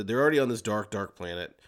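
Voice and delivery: ordinary delivery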